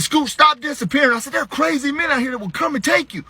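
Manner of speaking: high-pitched parental warning; worked-up dad telling off a child; frantic scolding. Retold or imitated. Scolding daughter who hid.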